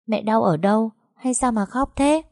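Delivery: put-on worried little girl. Little daughter asks her mother why she cries, childlike. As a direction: ask like a small child worried for mom. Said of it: "Con bé chẳng hiểu chuyện gì nên cứ xoa xoa bụng mẹ rồi hỏi".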